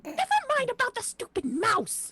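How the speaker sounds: high-pitched exasperated snap; exasperated outburst. Pretend. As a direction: cut off a distracted companion, play-acting irritation. Exasperated character cuts off companion.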